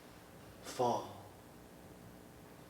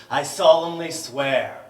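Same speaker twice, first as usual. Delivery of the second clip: shouting; 3 semitones higher, 13 dB louder than usual. Acted. Poem erupts again.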